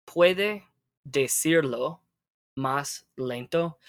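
slow, with a pause after "Puede" and "decirlo"; broken-down model phrase. Shown as a demonstration. Teacher models a request for learner, broken down slowly. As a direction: give "Puede decirlo más lento" word by word, slowly.

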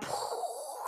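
quiet burst of blown air; imitated spitting puff. Describing being at boiling point, soft blown puff.

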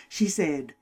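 ordinary delivery

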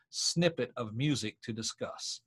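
ordinary talk, nothing marked

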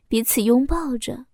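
usual speaking voice

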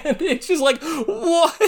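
high-pitched, laughing so hard the words crack; can't believe it, cracking up; laughing disbelief. Real, not acted. Vlogger reacting to the shock proposal, laughing in disbelief.

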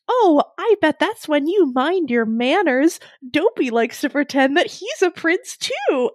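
high, slow sugary princess voice; sweetly catty like a princess. Retold or imitated.

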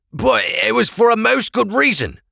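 high, thin voice of a frightened prisoner; pleading prisoner voice. Acted. Prisoner pleading with the Blackcoats, high and anxious.

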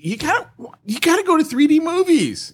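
high-pitched mock insistence; exaggerated, high exclamation; emphatic outburst. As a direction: urge it in a jump to high pitch. Mock outburst over two D.

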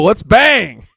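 high, loud whoop of a line; excited shout. Acted. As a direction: belt it out like a cheer. Crude joke shouted at co-host.

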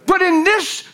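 high, ringing, impassioned sermon; full-force preaching. Real, not acted. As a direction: build to a high, urgent proclamation.

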